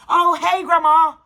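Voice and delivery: high, loud fake-sweet hello; mock cheery greeting. Greeting grandma on the phone in a mock sweet, high voice. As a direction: put on a bright phony welcome. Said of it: "I'm just like".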